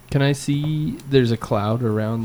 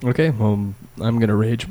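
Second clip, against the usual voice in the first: ordinary delivery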